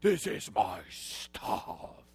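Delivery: slow, low monster-like rasp; hammed-up threat, slow and low; mock menacing possessiveness. People guard possessions from God, mock menacing voice. Parallels the son's warning.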